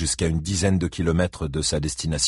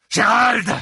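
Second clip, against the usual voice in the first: high, loud furious yell; yelled name; 5 dB louder than usual. A narrator's reading. Buddy Lee yells for Gérald outside his house, furious. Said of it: "hurla Buddy Lee".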